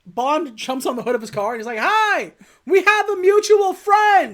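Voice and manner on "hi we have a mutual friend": high, loud, mock-cheery yell; gleeful shouted greeting. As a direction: holler it with manic cheer.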